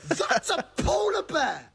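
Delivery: ordinary talk, nothing marked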